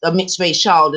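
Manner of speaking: usual speaking voice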